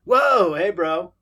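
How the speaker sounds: loud high whoa, hey bro; excited. Acted. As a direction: burst out with surprise. Excited greeting on the call.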